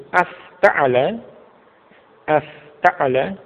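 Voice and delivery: "afta'ala" with the last syllable lifted like a question; questioning intonation. Shown as a demonstration. Teacher shows the Arabic question form, questioning intonation. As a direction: say "afta'ala" drawn out, tune rising at the end. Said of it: "suaranya juga menyesuaikan".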